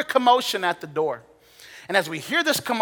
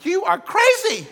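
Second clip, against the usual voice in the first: much higher, louder, slow frantic outburst; panicked thought; 12 semitones higher, 6 dB louder, slower than usual. Retold or imitated. Preacher replaying his inner panic at the calm lady, frantic and loud. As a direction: blurt the panic, half laughing.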